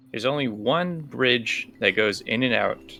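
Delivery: normal talking voice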